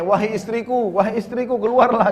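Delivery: high, urgent mock shout to his wife; imitated calling out. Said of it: "lalu teriak-teriak di depan rumahnya". (menirukan teriakan memanggil istri, nada tinggi)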